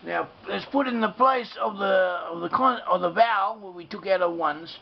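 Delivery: ordinary delivery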